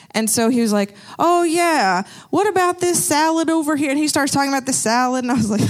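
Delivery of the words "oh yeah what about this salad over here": lightly voiced older man, higher pitch. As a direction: imitate a chatty older shopper, lightly. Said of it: "he was older".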